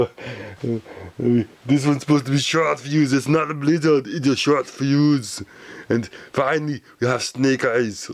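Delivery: a hint of an Austrian accent as he lists his toy figures; lightly voiced Schwarzenegger accent. Toy reviewer lists his figures in a light Austrian accent. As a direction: keep a light Austrian accent on every toy name, half-joking. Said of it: "We'll do it in Arnold Schwarzenegger voice".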